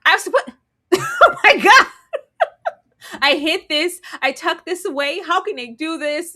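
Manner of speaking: hammed-up frantic confession; panicking woman. Women react to the raid, mock panic. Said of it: "It was like".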